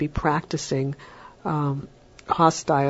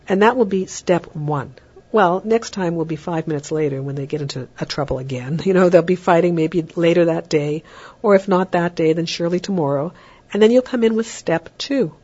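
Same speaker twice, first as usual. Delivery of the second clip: ordinary talk, nothing marked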